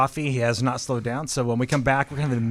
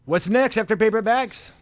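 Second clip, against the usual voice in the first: loud and high, throwing up hands; exasperated outburst; 6 semitones higher, 4 dB louder than usual. Real, not acted. Exasperated host on rant.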